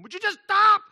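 high, loud fed-up parent shout; sudden exploding father; exasperated dad yell. Acted. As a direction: bark at noisy kids. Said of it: "he didn't say it in the same emotion".

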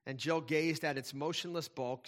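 ordinary talk

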